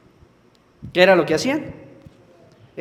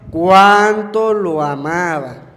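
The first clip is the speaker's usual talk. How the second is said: drawn out slowly and louder; slow emphatic echo; 4 dB louder, much slower than usual. Shown as a demonstration. Preacher repeats the people's words, slow and stressed. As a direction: lean on each word slowly. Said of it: "la gente comentaba".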